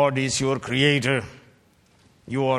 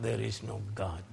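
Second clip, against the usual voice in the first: hushed fool's words; 4 semitones lower, 12 dB quieter, faster than usual. Retold or imitated. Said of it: "a fool has said in his heart".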